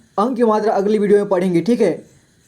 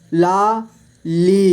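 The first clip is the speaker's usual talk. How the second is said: Lali split slowly into ला, ली; syllable by syllable; much slower than usual. Shown as a demonstration. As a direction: stretch and separate ला and ली in Lali. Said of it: "इस तरह से आपको". (लाली को तोड़कर धीरे: ला, ली)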